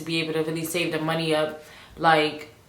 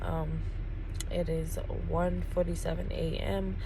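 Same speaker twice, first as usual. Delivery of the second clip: hushed, holding back feeling between pauses; emotional; 9 dB quieter than usual. Real, not acted. Driver documenting her move to Houston at night, emotional. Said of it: "Sorry, I'm a little emotional".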